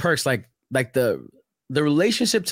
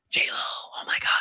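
hushed, whispered mock gasping; starstruck whisper; 7 dB quieter than usual. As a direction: imitate awestruck onlookers in a whisper. Onlookers outside cafe acted out.

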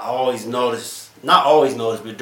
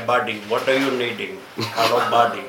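light Indian accent impression; a hint of an Indian accent, friendly. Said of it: "The Indian accent".

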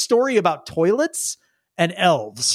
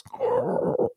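capybara growl; 5 dB quieter than usual. Acted. Capybara being hugged by little kid, soft growl. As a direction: rumble a soft growl like a pet. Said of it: "and Spud goes".

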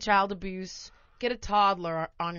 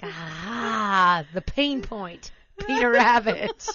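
mock villain; 6 dB louder, much slower than usual.

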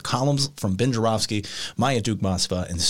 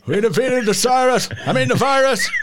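higher, louder mock Biden, hammed up; Biden imitation; 11 semitones higher, 4 dB louder than usual. Producer mocking Biden off the cuff to the host, hammed up. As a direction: ham up a rambling Biden. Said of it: "that's your imitation of Biden".

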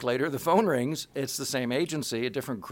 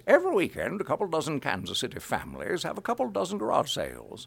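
put-on old New England announcer with swooping pitch; folksy old-timer's New England ad read; old New England man. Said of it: "talk like an old man from New England".

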